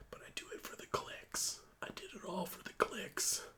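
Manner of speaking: very soft whisper; mock confession under the breath; whispered aside. Streamer admits doing it for clicks, whispered aside.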